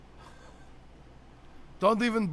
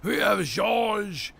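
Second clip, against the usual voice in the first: mock French accent; much slower than usual. Host imitates his French cousin, hammed-up French accent. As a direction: stretch it out like a cartoon Frenchman. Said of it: "It's, it's very French".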